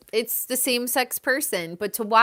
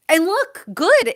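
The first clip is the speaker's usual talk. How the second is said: high, loud burst of approval; excited cheer; 9 semitones higher, 7 dB louder than usual. Real, not acted. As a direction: cheer her on, pitched up.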